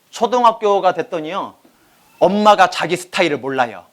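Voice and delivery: ordinary talk, nothing marked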